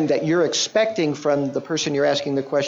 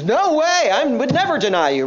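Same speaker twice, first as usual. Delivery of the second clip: high, loud no-way protest; indignant denial; 11 semitones higher, 4 dB louder than usual. Retold or imitated. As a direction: insist hard, offended at the idea. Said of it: "No, he says".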